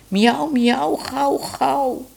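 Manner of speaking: bakery cat Leentje alarms Minoes, high meowing. A narrator's reading. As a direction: sing it out like a frantic cat. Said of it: "luid miauwend".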